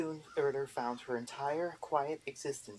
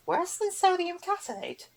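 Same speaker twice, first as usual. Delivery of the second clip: Erder voice; 6 dB louder than usual. A narrator's reading. Erder answers the boggart, high prim old witch. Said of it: "Erder asked".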